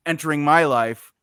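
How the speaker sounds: ordinary talk, nothing marked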